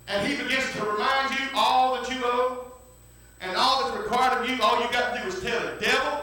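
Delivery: fervent, building sermon delivery; full-force preaching. Acted. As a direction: preach it out to the church. Preaching to the congregation.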